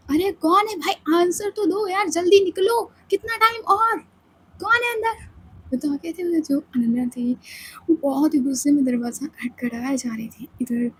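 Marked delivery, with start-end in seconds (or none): 0.1-5.3 high, demanding calls at the door; impatient friend. Acted. Ananya banging on the bathroom door, impatient and high. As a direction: hurry them out.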